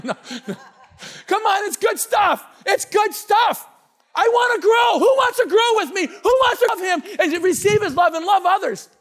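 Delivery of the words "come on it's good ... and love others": high, loud preacher's shout; excited screaming. Real, not acted. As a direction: shout it out to rally the congregation. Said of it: "I'm screaming, I'm getting excited".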